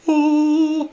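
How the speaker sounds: high, held angelic "ooh"; imitated heavenly "oh". Jesus statue opening his arms, high held note. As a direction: sustain one high note like a heavenly choir. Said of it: "as it opens".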